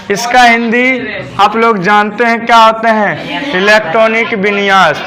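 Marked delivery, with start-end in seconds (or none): none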